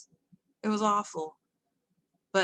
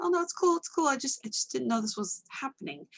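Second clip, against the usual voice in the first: much higher, brushing it off; high reassuring quote; 6 semitones higher than usual. Retold or imitated. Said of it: "I was like".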